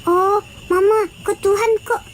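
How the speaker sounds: put-on high, curious child's questions; a small child asking mama. Child asks mama why Jesus wears no clothes, high and wondering. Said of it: "katanya kan".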